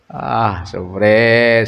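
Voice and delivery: ordinary talk, nothing marked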